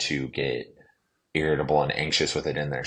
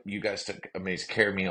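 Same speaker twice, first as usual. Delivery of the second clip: ordinary delivery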